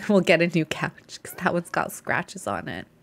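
ordinary talk, nothing marked

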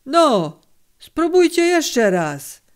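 high, slow urging call; encouraging. A narrator's reading. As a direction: push the men on, rising high and drawn out. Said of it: "zachęcił sztygar Konderla".

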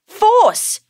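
high, loud yell of one word; boy yells, pointing at cutting wheels; excited yell. A narrator's reading. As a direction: shout it out proudly. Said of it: "yells Lorenzo".

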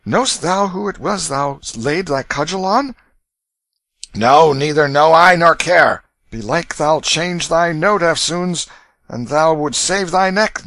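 high, urgent stranger's voice; urgent warning. A narrator's reading. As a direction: warn him to flee. Stranger warns Canty.

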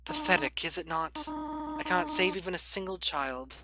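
put-on a grieving mother letting her child go; voicing Toriel sadly. Reads Toriel's lines aloud.

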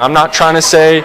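usual speaking voice

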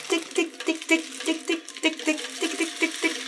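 high, small ticking; tiny staccato high-pitched pecks; pecking sound imitation. A narrator's reading. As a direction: tick like beaks tapping on wood. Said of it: "klonk het".

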